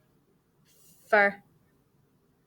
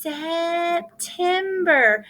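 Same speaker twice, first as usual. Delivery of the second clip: drawn-out word; 3 semitones higher, much slower than usual. Shown as a demonstration. Teacher models September slowly for the class. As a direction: say September slowly, stretching each part. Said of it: "Can you guys say that word?".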